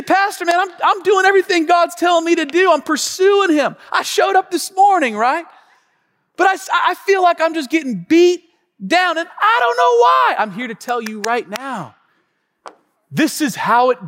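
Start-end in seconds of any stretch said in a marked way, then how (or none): 0.0-10.3 high, loud complaining believer; imitated congregant. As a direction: whine it as a frustrated churchgoer. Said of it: "You say".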